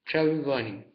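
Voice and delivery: talking with a full mouth; cheeks stuffed full. Real, not acted. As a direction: say it through cheeks full of marshmallows. Mouth crammed with marshmallows.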